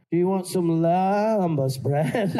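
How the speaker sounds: slow, stretched West Country vowels on lambas; overdone hobbit vowels. Retold or imitated. Sam offering Frodo bread in the exaggerated accent. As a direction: lean hard into the drawn-out vowels. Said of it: "Elijah Wood fell on the floor laughing".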